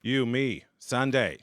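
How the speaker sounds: put-on loud Boston accent, tickets to the Sox; a thrilled Boston dad inviting his kid. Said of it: "a Boston accent with him".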